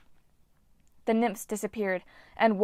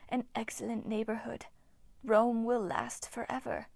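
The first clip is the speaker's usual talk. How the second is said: eerie breathy whisper of an ancient nymph; ghostly nymph whisper; 2 semitones higher, 6 dB quieter than usual. Acted. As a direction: hiss it like a withered water spirit. Nymph Hagno speaks.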